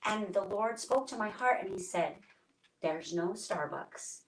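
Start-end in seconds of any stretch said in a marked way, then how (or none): 2.8-4.2 slow, measured and hushed; reverent quote. Retold or imitated. The Lord speaking to her heart among national parks, slow reverent quote. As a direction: let each phrase settle softly, unhurried.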